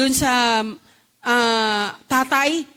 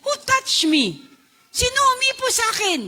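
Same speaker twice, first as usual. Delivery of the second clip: a raised, searching question; Jesus asking; 10 semitones higher, 3 dB louder than usual. Retold or imitated. Jesus demanding of the crowd who touched him, rising. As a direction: ask it sharply, searching the crowd. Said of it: "sabi ni Jesus".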